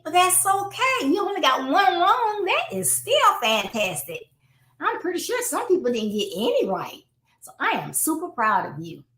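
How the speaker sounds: high-pitched, heartfelt reassurance; encouraging. Acted. Parent reassuring child about one wrong word, encouraging. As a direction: praise the child like you mean it. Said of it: "say it like you mean it".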